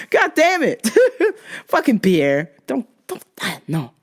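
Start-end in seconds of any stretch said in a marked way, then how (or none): none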